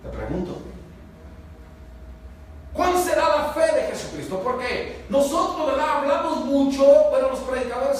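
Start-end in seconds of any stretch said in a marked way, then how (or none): 2.7-4.4 higher, louder repeat of the question; raised preacher's voice; preaching emphasis. Shown as a demonstration. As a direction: press the question home to the congregation. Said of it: "repeated louder".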